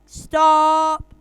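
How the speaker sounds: loud, drawn-out shout; big acted parental yell; dad's command. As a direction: shout it like a dad breaking up a fight. Child acts out dad breaking up fight.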